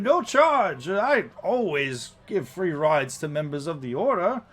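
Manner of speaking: high, loud, hearty greeting; showy coachman welcoming passengers with gusto; big, high-pitched theatrical host voice; jovial warforged coachman. Acted.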